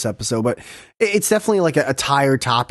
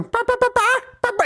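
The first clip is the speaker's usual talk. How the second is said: much higher imitated clucking bah; chicken noises; much faster than usual. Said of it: "making the chicken noises".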